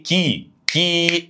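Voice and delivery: "كي" said as a long-held "kee"; KI demonstrated. Shown as a demonstration. Teacher shows students how it sounds mid-speech, "كي" drawn out as kee. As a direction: stretch the vowel of "كي" into a clear kee. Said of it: "بتبقى بتتنطق ايه؟".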